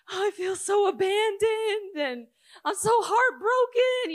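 high, loud, slow mock wail of heartbreak; mock heartbroken wail. A preacher mocking someone sobbing over rejected gum, high mock wail. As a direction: ham up the self-pity, high and drawn out.

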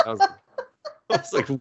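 ordinary talk, nothing marked

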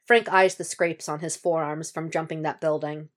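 plain reading voice

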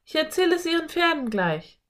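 put-on high-pitched girl character; eager girl. A narrator's reading. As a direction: voice a keen girl, pitched well up. Anne eager, springs up.

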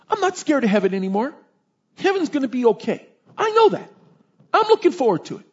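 put-on high-pitched, earnest little kid voice; five-year-old child. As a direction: imitate a small boy insisting he's fine. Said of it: "This is like five-year-old, six-year-old".

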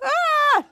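high-pitched mock scream; imitated scream. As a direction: act out a scream of panic. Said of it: "they're screaming".

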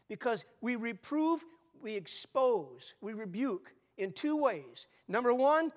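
loud, slow, pounding delivery; rising pulpit fervor; preaching at full force. Acted. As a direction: hammer each verb home. Preacher drives point loudly.

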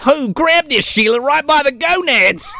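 high, hammed-up Australian yelp; mock Australian wrangler. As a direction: call out like an Australian animal wrangler. Acting out Irwin wrestling the boar.

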